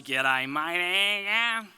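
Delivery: a proper outback Australian greeting; Australian accent. Shown as a demonstration. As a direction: lay on the old Aussie accent. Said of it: "when you say".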